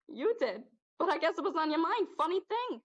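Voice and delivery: sly taunting. Acted. Possessor taunting the kid.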